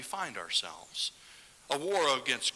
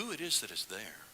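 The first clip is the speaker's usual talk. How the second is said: ordinary delivery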